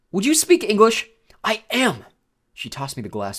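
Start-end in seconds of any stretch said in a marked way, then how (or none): 0.1-1.1 high, loud impatient interruption; frustrated raised-voice demand; exasperated. A narrator's reading. 1.4-2.0 high, snappy indignation; affronted, raised pitch; indignant retort. A narrator's reading.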